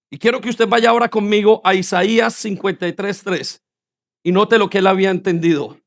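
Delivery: normal talking voice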